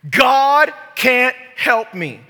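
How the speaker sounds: high, drawn-out, loud lament; loud mock despair. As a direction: wail the excuse theatrically. Acts out another excuse, loudly.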